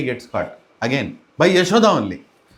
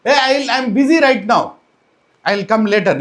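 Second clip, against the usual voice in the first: raised, higher voice brushing off a caller; harried retort; 5 semitones higher, 4 dB louder than usual. Retold or imitated. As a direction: call back that you're too busy. Busy Yashoda answers shouting gopi.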